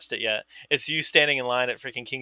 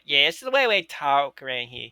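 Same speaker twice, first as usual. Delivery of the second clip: put-on Dundalk accent. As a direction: imitate the coworker's local Dundalk twang. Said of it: "that accent checked out".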